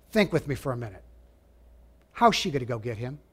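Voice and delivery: ordinary talk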